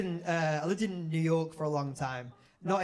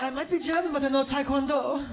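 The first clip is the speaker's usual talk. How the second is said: lightly voiced German guy, higher and louder; mock German tough guy; 8 semitones higher, 5 dB louder than usual. Big German guy threatening the comedian, mock boastful. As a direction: push it up into a comic boast.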